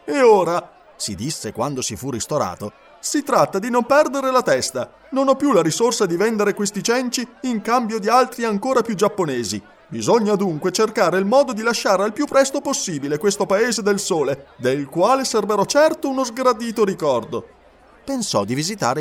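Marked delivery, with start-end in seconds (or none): 0.0-0.7 put-on higher, brighter-pitched young man thinking aloud; Passepartout's voice. A narrator's reading. Passepartout speaks to himself. 3.0-17.5 put-on higher, brighter-pitched young man thinking aloud; Passepartout's voice. A narrator's reading. Passepartout's monologue.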